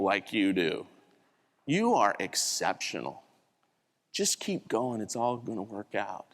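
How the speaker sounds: low, softened confiding voice; quiet reassurance. Retold or imitated. Friend consoles the bagger in the back, quietly reassuring. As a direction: encourage gently, as if taken aside. Friend privately encourages him after mishap.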